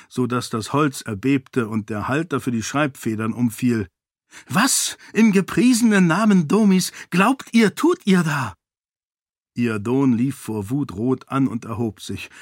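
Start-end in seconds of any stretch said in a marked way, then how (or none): 4.5-8.5 a much higher, louder, outraged challenge; furious demand. A narrator's reading. Sarene storms into the king's study, outraged and loud. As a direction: slam the question at him in fury.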